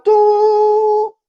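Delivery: loud, very high held "doo"; imitated dial-up tone. Host recalling AOL dial-up, loud high modem noise. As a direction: screech out a long modem tone.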